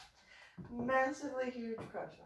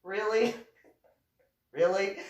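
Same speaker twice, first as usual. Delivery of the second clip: loud, incredulous jab; acted-out disbelief, much louder; loud challenge; 5 semitones lower, 12 dB louder than usual. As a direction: throw it out loudly as a challenge. Challenging him in retold quarrel.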